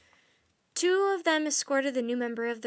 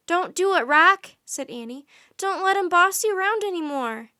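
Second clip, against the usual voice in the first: loud, high pleading call; urgent plea; 7 semitones higher, 11 dB louder than usual. A narrator's reading. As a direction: urge the bird to rebel. Girl pleads with the raven.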